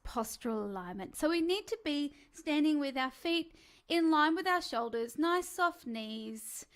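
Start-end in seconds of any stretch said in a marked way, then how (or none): none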